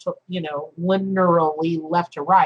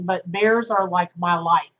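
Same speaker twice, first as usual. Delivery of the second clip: ordinary talk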